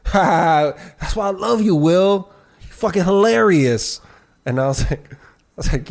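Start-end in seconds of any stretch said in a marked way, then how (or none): 0.1-4.0 high, laughing-it-off friend; hammed-up fake laugh then flattery; imitating the friend. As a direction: friend brushing off the insult with a laugh. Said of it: "he goes".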